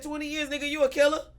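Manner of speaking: high, loud disbelief; shocked question. Retold or imitated. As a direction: react in shock at twenty years. Shocked reply to prison news.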